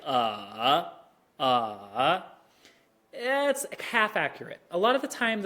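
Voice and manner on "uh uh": "uh" dipping low then swinging up; slow scooped "uh", down and back up; full dip-and-rise demo; textbook third tone. Shown as a demonstration. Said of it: "third tone is like".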